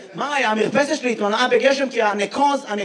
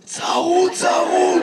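mock hoarse voice, higher and drawn out; hoarse impression, pitched up; imitating hoarse man; 5 semitones higher, much slower than usual. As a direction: imitate someone who has lost his voice. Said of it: "למה אתה נשמע ככה".

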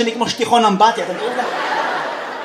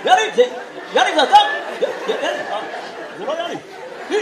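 peer like a rooster spotting a friend; mock rooster calling yalla; 3 semitones higher, 5 dB quieter, faster than usual. Imitating a rooster peering.

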